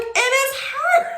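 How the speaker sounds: loud, very high, stretched-out punchline; high squealed exasperation; exasperated reveal. Retold or imitated. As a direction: blurt the reveal in comic dismay. Dramatic reveal of friend interrupting again.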